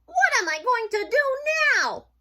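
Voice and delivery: high, loud storybook wail; dismayed wail. Acted. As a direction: wail in dramatic despair as a little mouse. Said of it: "wailed Mouse".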